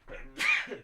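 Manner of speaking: a sudden sneeze; sneezing. Real, not acted. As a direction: sneeze into the mic. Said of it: "I just sneezed a bit there".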